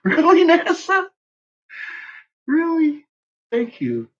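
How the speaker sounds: high, mock-incredulous really; sarcastic disbelief. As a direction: squeal it up in fake astonishment. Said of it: "I was like".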